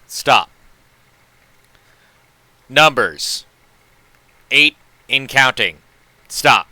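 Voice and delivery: loud, halting telegram read, every stop punched; telegram dictation. Acted.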